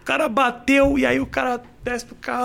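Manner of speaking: usual speaking voice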